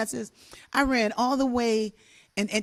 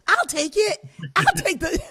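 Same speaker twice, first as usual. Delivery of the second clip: eager volunteering; 10 semitones higher than usual. Retold or imitated. Kid eagerly volunteering to help.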